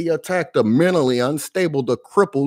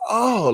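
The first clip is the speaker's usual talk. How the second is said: imitated cry; 10 semitones higher than usual. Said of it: "she just went".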